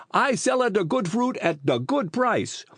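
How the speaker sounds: put-on high, indignant Italian-accented voice; Italian fruit seller. A narrator's reading. Fruit seller defends his prices to Phil, indignant Italian voice.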